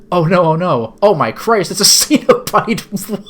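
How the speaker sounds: mock horror with a laugh; hammed-up alarm. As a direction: play horrified, cracking up. Heroine sees a monstrous captor.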